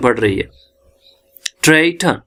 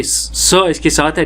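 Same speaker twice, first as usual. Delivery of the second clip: usual speaking voice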